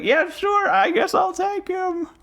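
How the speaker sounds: high, slow sarcastic shout; mock-reluctant falsetto shout; reluctant acceptance. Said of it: "I was like".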